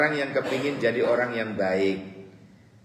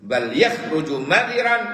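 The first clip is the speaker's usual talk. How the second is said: emphatic preaching; 7 semitones higher, 8 dB louder than usual. Acted. Preacher repeats Arabic line loudly.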